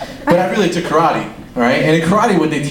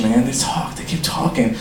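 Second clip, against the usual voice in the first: ordinary talk, nothing marked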